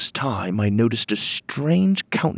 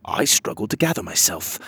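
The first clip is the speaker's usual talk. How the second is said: plain reading voice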